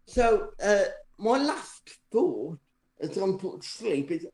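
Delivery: ordinary delivery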